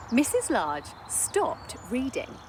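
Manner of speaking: usual speaking voice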